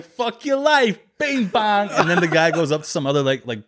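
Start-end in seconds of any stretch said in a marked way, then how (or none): 0.0-1.9 much higher New York street-guy impression; high, punchy mock New Yorker; guy from video. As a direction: imitate a guy heckling from a car. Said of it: "This guy, like, leans out of the car".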